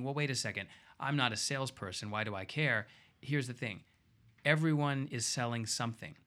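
normal talking voice

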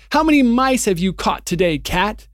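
high, loud mocking taunts; jeering creatures. The witch's followers taunting Aslan as a cat, high loud jeering. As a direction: taunt the bound lion like a cruel crowd. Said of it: "jeering at him".

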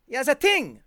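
put-on "ting" said with a hard t instead of th, in a French accent. French doctor's word repeated for the podcast host, French-accented "ting". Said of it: "he was a French, a francophone".

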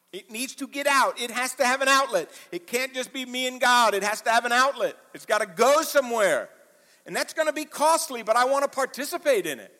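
high, loud, driving preacher voice; full-force preaching. Acted. Preacher pressing his flock, in full-force preaching. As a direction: preach it out to the congregation, building.

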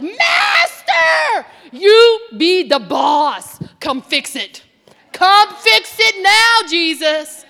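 shouting out to the master; desperate shout. Retold or imitated. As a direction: cry out for help, loud. Desperate cry to Jesus.

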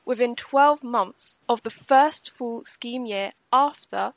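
very slow, measured explanation of a rule; deliberately slow. Real, not acted. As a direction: spell out the timing slowly and carefully. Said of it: "I'll say this slowly".

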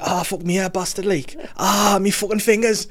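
high-pitched Geordie yelps of pain; comically injured Geordie; hurt Geordie. Acted. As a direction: moan in a put-on Geordie accent. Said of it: "in that accent if he gets hurt".

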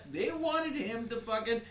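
normal talking voice

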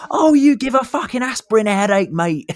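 very high, loud mock yell; hammed-up scream at the top of the range; retold screaming. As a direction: screech it in exasperation, imitating an angry rant. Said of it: "he's screaming at them".

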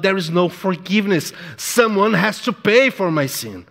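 normal talking voice

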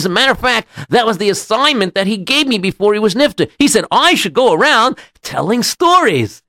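high, singsong assurance; eager rabbi. Acted. Rabbi assures the local he is no thief, eagerly insistent. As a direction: bounce upward with proud insistence.

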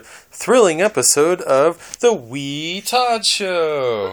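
big booming show intro; slow, grand host announcement; announcer voice. Acted.

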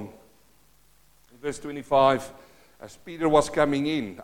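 normal talking voice

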